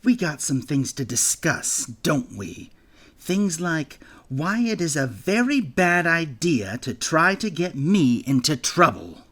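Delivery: businesslike tone. A narrator's reading. Bully Gale laying down terms to Jack, businesslike menace. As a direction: lay down the rules like a deal. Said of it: "in his most businesslike tone".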